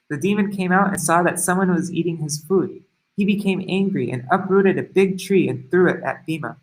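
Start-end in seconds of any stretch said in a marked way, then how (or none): none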